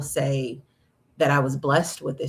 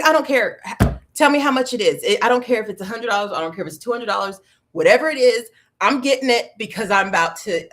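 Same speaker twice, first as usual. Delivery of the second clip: loud, fast, impatient demand; frantic urgency, louder and faster; desperate insistence; 5 semitones higher, 5 dB louder, faster than usual. Retold or imitated. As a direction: rattle it off like money's no object. Desperate to buy the medication.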